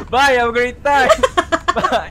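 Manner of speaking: loud, drawn-out goodbye breaking into laughter; laughing mock farewell. Joking as friend falls in game.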